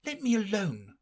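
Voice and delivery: put-on high girl, lightly annoyed; Alice's voice. Alice shooing off the pigeon, mildly cross girl voice. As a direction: push the bird away, mildly cross.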